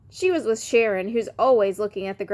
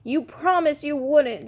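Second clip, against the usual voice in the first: slow, higher whine; whining; 6 semitones higher than usual. Acted. Daniel whines at Elaine, slower and higher. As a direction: drag it out like a whiny little kid. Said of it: "Daniel whined".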